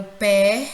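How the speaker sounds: pé loud and held on its own; stressed syllable. Shown as a demonstration. Teacher pointing out the tonic syllable, loud stretched pé. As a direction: punch out pé as the strong syllable. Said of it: "é a sílaba mais forte".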